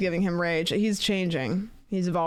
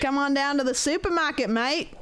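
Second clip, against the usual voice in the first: higher, playful Australian impression; Australian accent; 5 semitones higher than usual. Streamer repeating after Australian friend, put-on Australian accent. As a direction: echo a friend in broad Australian. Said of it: "know how to say with an accent".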